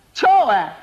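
high, loud, impatient shout; urgent coded call. Acted. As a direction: shout the signal to a slow apprentice. Repeats signal more urgently.

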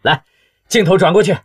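loud, high-pitched TV show host; tense excited host. A narrator's reading. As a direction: announce the big reveal, bursting with barely contained gossip. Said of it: "紧张地说道".

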